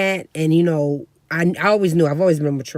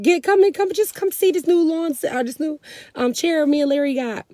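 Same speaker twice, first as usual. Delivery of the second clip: coaxing aunt imitation; 11 semitones higher than usual. Aunt coaxing mom to see chair.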